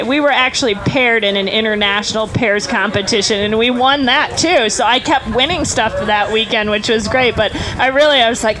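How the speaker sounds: ordinary talk, nothing marked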